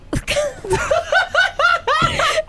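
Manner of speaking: high squealing laugh-groan; half-laughing camel noise attempt; grumbling camel voice. Shown as a demonstration. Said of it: "can you make the sound?".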